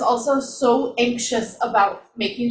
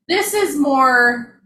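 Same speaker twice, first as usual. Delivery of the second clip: ordinary delivery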